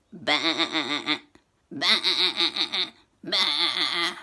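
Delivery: loud bleating baa; playful loud baa; sheep baa. Acted.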